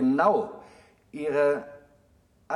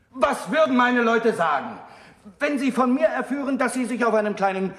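louder and higher, building passion; rising urgency; 6 semitones higher, 4 dB louder than usual. Acted. Young monk pleading passionately with Galileo, louder and higher. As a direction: pour it out as the thought overwhelms. Monk's speech builds to climax.

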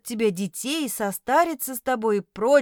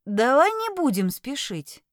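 ordinary delivery

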